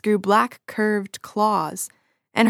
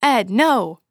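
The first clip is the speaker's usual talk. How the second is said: higher, louder cry of alarm; alarmed shout; 4 semitones higher, 5 dB louder than usual. Acted. Several men shout to stop Ed's attack.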